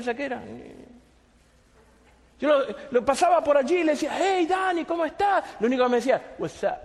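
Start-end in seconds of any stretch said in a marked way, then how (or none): 4.1-5.4 higher-pitched, upbeat hello; cheery greeting. Retold or imitated. Acting out his friendly greeting in passing. 6.4-6.8 low, offhand greeting; with attitude. Retold or imitated. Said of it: "Así con actitud".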